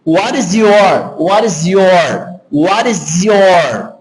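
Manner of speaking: WHAT IS YOUR slowly fused into one word, tapped T; linked pronunciation. Shown as a demonstration. Teacher drilling the connection for students, slow and linked. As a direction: stretch "what is your" out, all joined. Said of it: "Veja que tudo se uniu".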